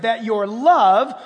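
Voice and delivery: love drawn out slowly with weight; slow, weighty reading; slow stressed love. Shown as a demonstration. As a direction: linger on love. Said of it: "circle the word love".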